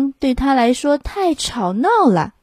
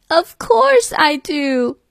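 lamb voice; 5 semitones higher, slower than usual. Acted. Lamb's voice in story.

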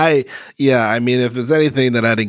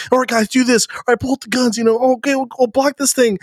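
high, bright mock team call-outs; scripted gamer chatter; 11 semitones higher, faster than usual. Parodying scripted trailer voice chat, higher and mock-cheerful. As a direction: parody fake-friendly squad talk. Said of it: "they're talking as far as".

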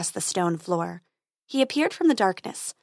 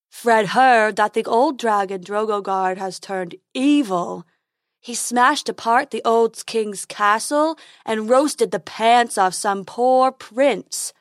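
slow, loud, dopey unicorn voice; silly unicorn; 5 dB louder, slower than usual. Acted. Unicorn reports dragon's misdeeds to Dentina, slow silly voice. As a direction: drag out the gossip as a silly creature.

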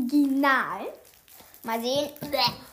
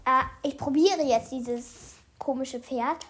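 usual speaking voice

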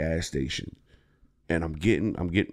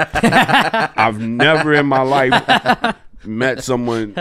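slow, higher, laughing through it; laughing disbelief; 6 semitones higher, 6 dB louder, much slower than usual. Real, not acted. Joking about a friend's accent, laughing and slow. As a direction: laugh out the words.

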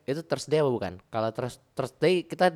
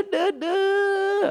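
mock panic. Squeaky panicked mock exclamation.

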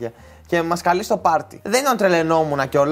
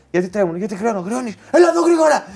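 imitated cop order rising to a high raised voice; retold shouting; 5 semitones higher than usual. Cop questions the friend's shaking hands, mock high-pitched shouting. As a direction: build into a mock police yell. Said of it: "Και του φώναζε".

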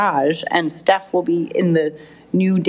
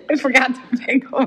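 laughing; 6 semitones higher than usual. Real, not acted. Laughing at own mistake.

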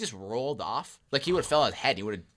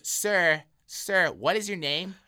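stern concierge; 4 semitones higher, 6 dB louder, slower than usual. Retold or imitated.

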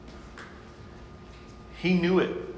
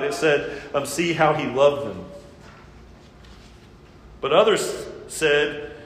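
louder, driving sermon delivery; fervent preaching; 4 semitones higher, 4 dB louder than usual. Acted. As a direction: press the point home to the congregation. Rising to emphatic preaching.

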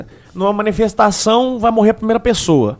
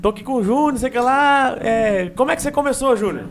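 guest voice; 5 semitones higher, 5 dB quieter than usual. Retold or imitated. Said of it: "o convidado tá falando assim".

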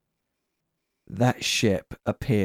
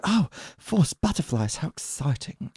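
internal monologue voice; 5 semitones higher, 5 dB quieter than usual. Retold or imitated. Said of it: "that's my internal monologue voice".

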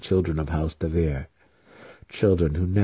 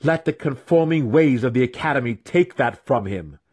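much higher, impassioned plea; passionate defiance; 9 semitones higher than usual. Acted. Weapon master defending Drizzt before Matron, impassioned. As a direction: pour out his fervor.

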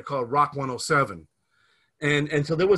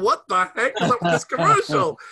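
baffled; 5 semitones higher, 6 dB louder than usual. Retold or imitated. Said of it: "the three of us sitting there going".

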